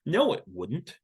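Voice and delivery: usual speaking voice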